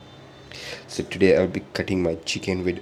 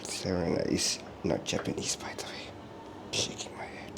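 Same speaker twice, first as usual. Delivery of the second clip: a dry whisper, shaking head at it; whispered aside; 11 dB quieter than usual. Real, not acted. Whispering to viewers, correcting the fake Japanese word.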